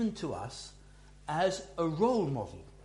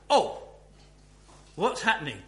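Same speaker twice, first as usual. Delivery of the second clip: surprised reaction; 2 semitones higher, 7 dB louder than usual. Acted. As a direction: act out a reader caught by surprise. Acts out a surprised reader's reaction.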